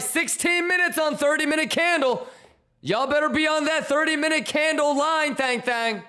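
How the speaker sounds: high squeaky announcer voice; silly clown. Acted.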